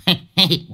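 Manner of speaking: high gloating laugh; a sly teasing chuckle; mocking cackle. A narrator's reading. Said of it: "nhe răng". (cười khẩy chế giễu)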